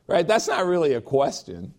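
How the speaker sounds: ordinary delivery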